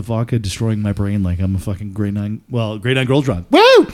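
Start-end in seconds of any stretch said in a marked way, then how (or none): 3.5-3.9 a much higher, louder whoop. Real, not acted. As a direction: let out a party whoop. Cheering drunk.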